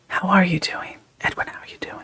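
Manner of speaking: very soft, whispered greeting. Real, not acted. Streamer privately greeting Edwin after his plea, whispered. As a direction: drop right down to a hushed whisper. Hushed greeting to one viewer.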